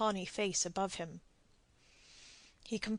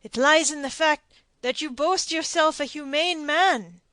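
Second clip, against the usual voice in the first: higher and louder, pressing his point; arguing don; 6 semitones higher, 9 dB louder than usual. A narrator's reading. Don Diego argues for his life, insistent. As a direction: seize on the argument.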